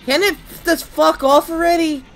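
high, fed-up complaint; exasperated outburst. Real, not acted. Player fed up with the monster, exasperated outburst. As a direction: raise the pitch in real annoyance.

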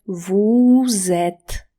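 slow teaching demo, stretching "vous"; drawn-out VOUS. Shown as a demonstration. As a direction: exaggerate the long vowel in "vous" to demonstrate. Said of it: "jak ja przeciągam".

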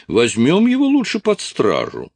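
high-pitched indignant character voice; put-on high voice of an outraged crony. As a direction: pipe up high, demanding an arrest. Strus's friend demands an arrest.

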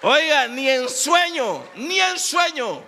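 high, loud and slow; amazed exclaim. Real, not acted. Preacher marveling to the congregation, loud and slow. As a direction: stretch out the disbelief, loud.

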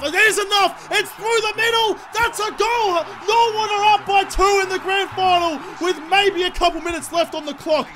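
high, loud, thrilled shout; excited goal call. Real, not acted. Gamer seeing the lead goal sail through, excited shout. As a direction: celebrate the go-ahead goal.

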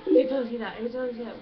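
loud, urgent self-talk, said twice over; nervous retold panic. Vlogger recalling her nerves on the date, panicky. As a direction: act out a flustered inner panic. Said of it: "nervous, and I was like".

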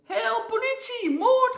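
very high, loud shrieks of panic; hammed-up piercing cry for help; screaming for help. A narrator's reading. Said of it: "gilde hij".

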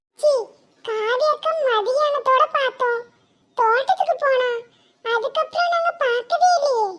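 put-on a small kid asks innocently; child voice. Child tells grandmother. (சிறுவனின் உயர்ந்த குரல்)

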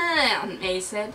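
plain reading voice

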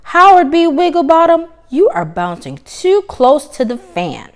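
urgent teacher call; calling out warning. Acted.

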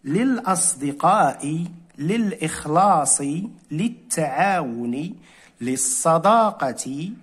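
slow careful reading. Shown as a demonstration. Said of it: "إذن".

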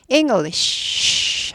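English slowly, ending in a long voiceless shhh; whispered long sh. Shown as a demonstration. Teacher showing the ending, English with whispered sh. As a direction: stretch the final sh of English as breath. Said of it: "속삭여야지 한 음절이 안 되는".

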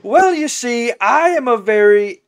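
high, loud, theatrical sheep voice; rambling talking sheep. Acted. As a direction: launch grandly into a long explanation. Talking sheep begins his tangent.

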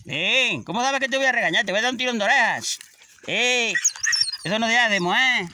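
scolding the dog for misbehaving. Real, not acted. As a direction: tell off a naughty dog.

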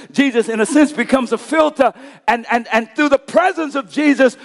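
loud, high-pitched sermon delivery; impassioned preaching. Real, not acted. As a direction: build it toward a ringing proclamation. Preaching building to a climax.

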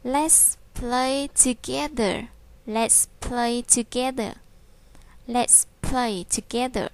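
slow and clear, every word spaced; slow model reading. Shown as a demonstration. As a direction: model it slowly for young learners. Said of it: "mình sẽ nói là".

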